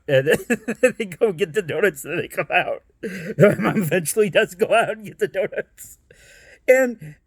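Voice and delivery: ordinary talk, nothing marked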